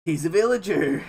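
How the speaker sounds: loud mock wonder; hammed-up villager amazement; dude in awe. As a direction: call it out like an amazed stranger. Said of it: "some dude was just like".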